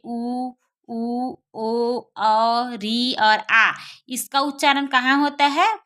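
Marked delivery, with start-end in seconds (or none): 0.0-3.7 उ, ऊ, ओ, औ, री, आ each said separately and drawn out; vowels spelled out slowly. Shown as a demonstration. Teacher listing back vowels to students, slow and careful. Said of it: "क्या क्या बचे?".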